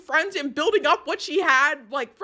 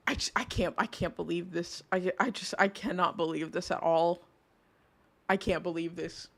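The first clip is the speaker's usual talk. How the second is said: stunned disbelief; 8 semitones lower, 8 dB quieter than usual. Real, not acted. Streamer stunned by news.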